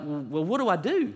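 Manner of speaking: put-on a frightened boy begging for an answer; scared kid. Boy pleads with grandmother, high and panicky. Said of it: "And I'm like".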